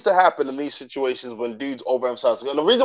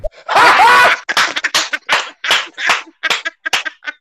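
high, loud burst of laughter; loud laughing fit; 12 semitones higher, 5 dB louder than usual. Real, not acted. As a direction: crack up completely, long and high. Cracking up with guest.

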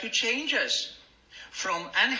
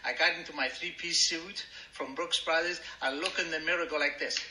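ordinary talk

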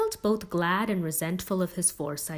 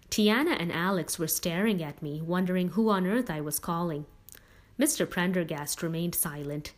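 ordinary delivery

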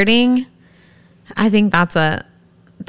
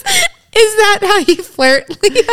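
high, slow laughing question; laughing tease; 12 semitones higher, 3 dB louder, slower than usual. Real, not acted. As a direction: giggle it out teasingly. Teasing friend, laughing.

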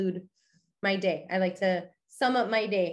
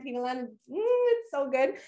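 food delight; 5 semitones higher than usual. Real, not acted. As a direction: swoon over the shawarma. Savouring her favourite food.

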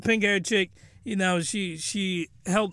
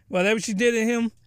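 usual speaking voice

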